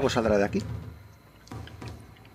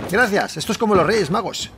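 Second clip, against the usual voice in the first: usual speaking voice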